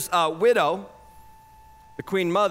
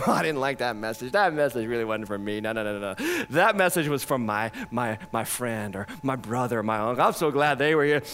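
lower, playful mock of a listener brushing off the sermon; lightly voiced, a complacent listener shrugging it off; dismissive churchgoer; 10 semitones lower, 3 dB quieter than usual. Preacher mocking a churchgoer who dodges the message, lower mock voice. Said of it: "isn't that just the way that we live".

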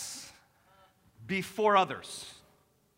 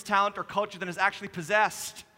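normal talking voice

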